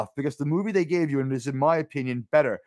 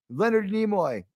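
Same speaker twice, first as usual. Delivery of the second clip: ordinary talk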